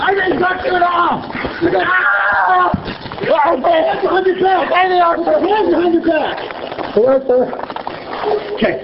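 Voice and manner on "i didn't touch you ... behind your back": high, frantic pleading; panicking under arrest; panicked. Real, not acted. Panicked man being detained by police.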